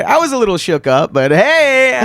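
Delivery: loud, high, climbing to a showy hey; jokey upbeat lift; mock cheerful shrug-off. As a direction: brush it off with hammed-up cheer. Jokily brushing off the seizure.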